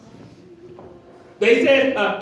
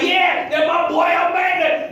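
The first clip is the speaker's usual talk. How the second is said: high, excited proud cheer; cheering angels; 8 semitones higher than usual. Acted. Angels cheering for their boy, high proud cheer. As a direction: cheer him on from the heavens. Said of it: "the angels are cheering".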